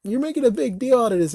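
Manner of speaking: mock critic. Mimicking people who dismiss his complaint, whiny high. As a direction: sneer it like a dismissive commenter. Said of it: "people will be like".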